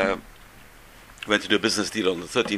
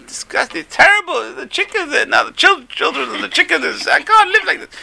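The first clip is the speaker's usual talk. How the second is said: much higher, louder exasperated wail; desperate farmer; 6 dB louder, slower than usual. Retold or imitated. Farmer crammed in with chickens wails to the rabbi, high and exasperated. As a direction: whine it out like a man at his wit's end.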